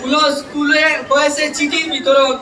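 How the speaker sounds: loud, measured public recitation of verse; poem recitation. Acted. Trainee reciting a farewell poem to college teachers, declaimed loudly. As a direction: declaim rhymed tributes line by line, pausing between phrases.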